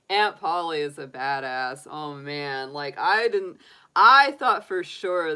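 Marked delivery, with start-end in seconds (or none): none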